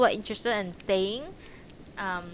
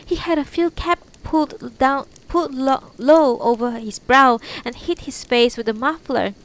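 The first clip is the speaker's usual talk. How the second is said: frightened witness voice; 5 semitones higher, 6 dB louder than usual. Acted. Tamayo retells the scare to police.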